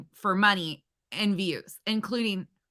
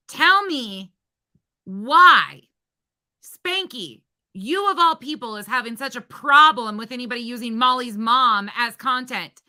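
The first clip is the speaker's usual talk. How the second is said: angry rant; 5 semitones higher, 5 dB louder than usual. Real, not acted. Ranting at Spanky over content.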